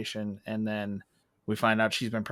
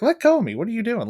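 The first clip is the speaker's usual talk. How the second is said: high, loud imitation of an affronted princess; mock outrage, pitched way up; indignant attitude; 11 semitones higher, 6 dB louder, faster than usual. Jasmine to the merchant who grabs her, imitated with indignant attitude. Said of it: "she gets an attitude".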